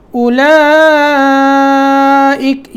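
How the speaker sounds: اولئک very slowly, the madd drawn long; lengthened madd. Shown as a demonstration. Teacher demonstrating madd, very slow. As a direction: stretch the vowel in اولئک. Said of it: "یہاں کیا ہے؟ مد کرنی ہے".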